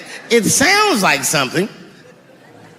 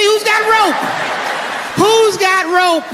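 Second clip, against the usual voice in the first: much higher, shouted disbelief; incredulous shout; 11 semitones higher, slower than usual. Real, not acted. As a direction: yell it at the absurdity. Outraged rhetorical question.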